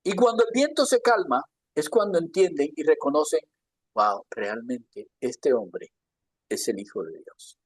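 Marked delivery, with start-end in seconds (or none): 3.9-7.6 low, slow, dropping to very soft; hushed awe. Acted. Those in the boat recognising Jesus, hushed awe. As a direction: let amazement sink the voice away.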